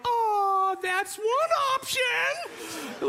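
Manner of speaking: very high, drawn-out cartoon voice; squeaky mock-cheery cartoon; cartoon character. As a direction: imitate a cartoon covering awkwardly. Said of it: "the cartoon character is now like".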